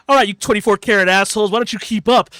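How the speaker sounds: loud, fast cocky taunt. Retold or imitated. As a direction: bark it like a smug tank. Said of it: "and then he goes".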